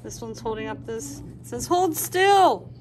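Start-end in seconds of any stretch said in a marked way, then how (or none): 1.7-2.7 higher and louder, a commanding little voice; bossy character voice. Acted. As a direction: tell them firmly to hold still. Character speaks in picture book.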